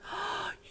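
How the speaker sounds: sharp high breathless gasp; girl shocked by screaming plants; horrified gasp. Acted. Sophie horrified.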